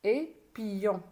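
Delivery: teacher's spelled-out "P-A, pa... et pion"; each piece of "P-A, pa, et pion" set apart; syllable by syllable. Shown as a demonstration. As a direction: spell P-A, blend it to "pa", pause, then "pion". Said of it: "J'ai séparé le mot en syllabes".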